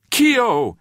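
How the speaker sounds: loud, high-pitched call; shout. A narrator's reading. Man calling out in empty streets, shouting. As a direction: shout it out to a missing friend. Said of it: "he shouted".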